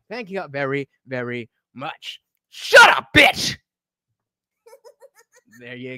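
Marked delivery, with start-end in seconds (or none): 2.5-3.6 loud and high; loud playful shout. Real, not acted. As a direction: bark it out playfully. Playful outburst.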